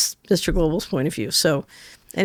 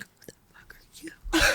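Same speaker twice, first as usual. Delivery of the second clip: whispered, much softer disbelief; stunned whisper; 11 semitones higher, 12 dB quieter than usual. Retold or imitated. Client stares at her, whispering in stunned disbelief. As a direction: breathe it out in shock.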